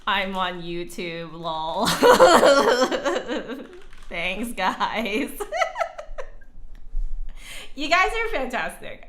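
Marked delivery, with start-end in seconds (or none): 1.9-4.0 bright burst of laughter; loud laugh. Real, not acted. Laughing at chat. 5.3-7.7 airy laugh. Real, not acted. Laughing at chat. 7.7-9.0 excited. Real, not acted. Praising chat.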